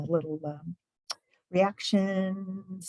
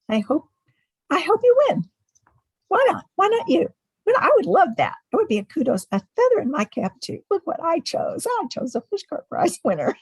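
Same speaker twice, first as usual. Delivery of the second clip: much higher, louder, thrilled voice; excited gushing; 11 semitones higher, 7 dB louder, faster than usual. Real, not acted. Host excitedly tells the nominee she hopes she wins. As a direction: gush with hope for the win.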